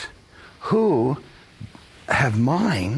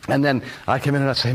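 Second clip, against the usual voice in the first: ordinary talk, nothing marked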